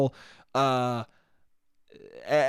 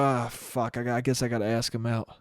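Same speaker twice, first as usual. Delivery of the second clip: lower, softer, grudging mutter; reluctant groan; 5 semitones lower, 5 dB quieter than usual. Retold or imitated. Now jaded at having to ask someone out, grudging mutter. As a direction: sound put upon.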